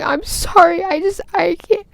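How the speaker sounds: mock-sob through a broken-off apology; imitated crying. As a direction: act out crying, unable to finish. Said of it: "you don't need to cry".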